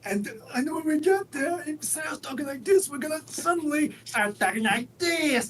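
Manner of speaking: very high, strained villain voice; Skeletor. Acted. Co-host joking about their old age, high villain voice. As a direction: push it high and cackly, cartoon villain. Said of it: "so we can talk like Skeletor".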